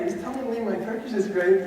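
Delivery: ordinary delivery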